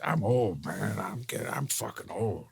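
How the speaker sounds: soft, weary veteran actor impersonation. Retold or imitated. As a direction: trail into a hushed old-timer's complaint. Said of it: "And he goes".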